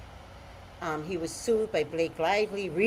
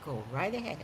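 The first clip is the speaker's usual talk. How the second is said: sarcastic God; 3 semitones higher, 10 dB quieter than usual. Retold or imitated. God mocking her to the viewers, sarcastic. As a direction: brush them off with a lightly sarcastic go ahead. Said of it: "And God's like".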